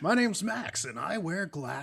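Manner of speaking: smooth radio host intro. Shown as a demonstration. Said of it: "In a radio voice".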